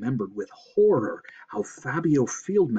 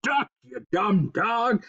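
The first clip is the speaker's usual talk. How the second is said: drawn-out, slow yell; slow, raised-voice warning; 5 semitones higher, slower than usual. A narrator's reading. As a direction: holler it at the dog. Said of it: "Harold Blevins yelled".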